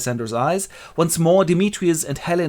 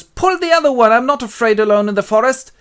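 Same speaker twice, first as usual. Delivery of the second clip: high, loud bluster; defiant Bottom; 11 semitones higher, 9 dB louder than usual. Acted. Bottom left alone in the forest, defiant. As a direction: protest loudly in a high voice. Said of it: "Bottom goes".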